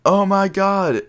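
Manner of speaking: high-pitched mock horrified cry; imitated horror. Friend horrified at the car window, imitated high horrified cry. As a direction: imitate someone aghast, pitched well up. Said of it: "he was horrified".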